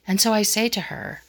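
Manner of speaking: ordinary talk, nothing marked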